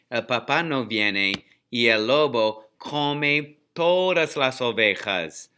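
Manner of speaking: plain reading voice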